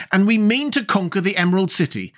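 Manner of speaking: high, impatient declaration; impatient insistence. A narrator's reading. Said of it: "stamping her foot impatiently".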